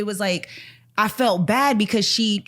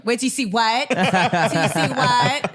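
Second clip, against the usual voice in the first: laughing mock girl; 3 semitones higher than usual.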